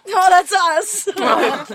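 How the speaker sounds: high, loud words through laughter; laughing. Real, not acted. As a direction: laugh it out slowly. Laughing at guest's joke.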